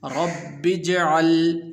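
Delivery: slow sung tajweed recitation; Quran recitation. Shown as a demonstration. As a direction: chant each word long and melodic. (ترتیل کے ساتھ تلاوت)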